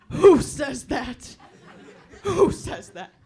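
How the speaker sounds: loud, laughing disbelief at herself; laughing outburst. Real, not acted. Comic mocking her own oyster line with a laughing shout. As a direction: blurt it out laughing at yourself. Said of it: "self-mocking outburst".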